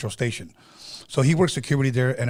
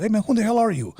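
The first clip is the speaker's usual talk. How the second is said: high-pitched mock confrontation; retold challenge; 9 semitones higher, 4 dB louder, faster than usual. Brother confronts an unknown guy at the club, mock challenge.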